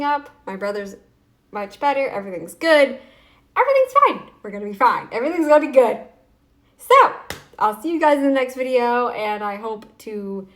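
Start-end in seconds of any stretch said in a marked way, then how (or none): 3.5-4.3 overbright. Real, not acted. Overbright reassurance. 6.8-7.1 high, loud squealed so; excited squeal. Real, not acted.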